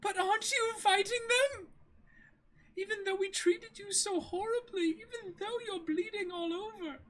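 put-on high, apologetic young woman's voice; Kaya, remorseful girl.

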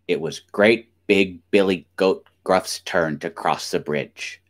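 ordinary delivery, nothing marked

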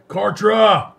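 loud, high call for someone; full-voiced searching yell. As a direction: shout the name out across a hall. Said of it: "I'm gonna yell".

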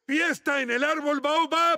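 high, loud, slow teasing shout; mock party shout. Maurice waking the prince with a fake party call, loud teasing shout. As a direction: yell it to jolt him awake, teasing.

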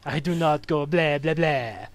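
lightly hammed-up Transylvanian vampire accent; a hint of Hotel Transylvania Dracula, denying the cliché. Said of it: "Dracula from Hotel Transylvania".

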